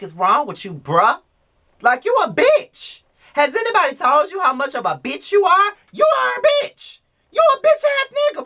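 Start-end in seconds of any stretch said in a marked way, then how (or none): 1.8-7.0 much higher, rising yell; screaming. Real, not acted. Vlogger yelling at the TV star, screaming. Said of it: "the way I screamed and yelled". 7.3-8.4 very high, fast shriek; screaming. Real, not acted. Vlogger shrieking at a reality star, screaming. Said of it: "the way I screamed and yelled".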